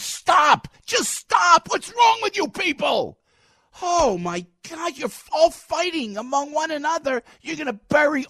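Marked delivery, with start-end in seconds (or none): 0.0-6.5 exasperated shout. Real, not acted. Host yelling at Republicans bashing each other, exasperated. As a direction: shout them down, fed up.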